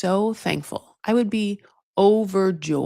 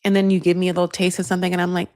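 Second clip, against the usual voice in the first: ordinary talk, nothing marked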